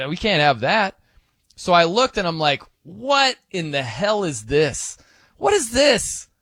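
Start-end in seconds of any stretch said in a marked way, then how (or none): 2.8-6.3 mock shock. Shocked at Google results.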